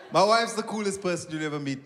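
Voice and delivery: usual speaking voice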